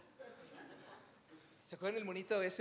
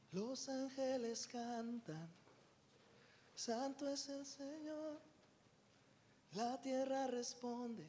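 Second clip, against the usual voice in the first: hushed angelic voice; 6 semitones higher, 12 dB quieter, slower than usual. Acted. Angels singing holy, earth answering.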